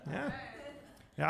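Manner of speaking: ordinary talk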